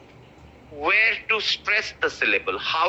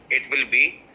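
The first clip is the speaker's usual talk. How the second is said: ordinary talk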